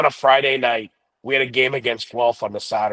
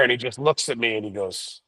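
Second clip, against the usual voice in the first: ordinary delivery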